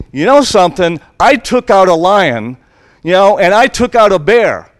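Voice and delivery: declaring a triumph to the king; bold boast. Acted. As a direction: thump the chest as young David. David boasting to Saul.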